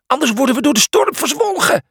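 high panicked wail; very high, alarmed cry; shrieking. A narrator's reading. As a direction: shriek a warning of doom. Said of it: "gilde Cornelius".

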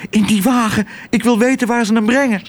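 high, hurried bird voice; panting sparrow. A narrator's reading. Exhausted sparrow asks for help following the car, panting. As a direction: push the words out high and urgent. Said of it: "hijgde Toep".

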